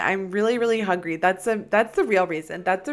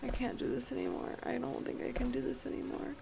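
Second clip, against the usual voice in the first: hushed, higher frightened voice; small voice, too scared to go on; quiet pleading fear; 11 semitones higher, 13 dB quieter than usual. Real, not acted. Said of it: "I'm very scared".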